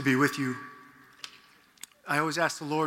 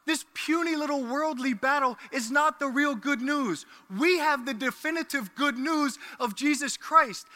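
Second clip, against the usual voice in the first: louder preacher voice, driving the point; preaching at full force; 7 semitones higher, 3 dB louder than usual. Acted. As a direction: proclaim it to the whole church.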